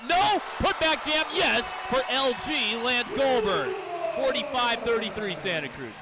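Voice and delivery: usual speaking voice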